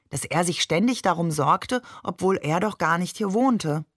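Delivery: plain reading voice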